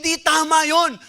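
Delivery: high, loud indignant outcry; the angry king demands justice, high and loud; burning anger. Acted. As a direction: cry out against the injustice like an outraged king. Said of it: "He was burning with anger".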